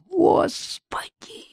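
very high, slow, breathless gasp; terrified whispered cry. Acted. Roberta panicking, a terrified whisper. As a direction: gasp it out in terror.

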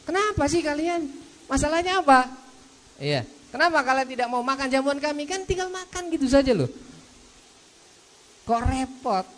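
exasperated host. Retold or imitated. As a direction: scold them lightly for the fuss.

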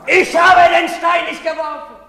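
high, loud cry of innocence; desperate shout. Acted. Andri screams his innocence at his father. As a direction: scream the denial.

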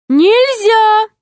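high, barking little guard; stern guard. Acted. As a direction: shut them up like a jailer. Lemur guard. (строгий окрик стражника)

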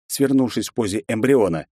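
ordinary delivery, nothing marked

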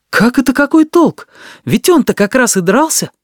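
high, heated protest; indignant. A narrator's reading. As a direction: burst in with a loud objection. Natasha breaks in indignantly. (возмущённый возглас высоким голосом)

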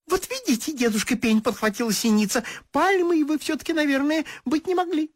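a little bird gently correcting; titmouse voice. Acted. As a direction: titmouse telling the stump he couldn't be a palm.

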